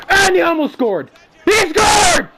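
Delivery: very high, loud game yell; excited shout. Real, not acted. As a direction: yell at the goal on screen. Reacting to a goal in game.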